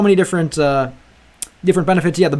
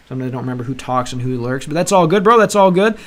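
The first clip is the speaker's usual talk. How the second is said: normal talking voice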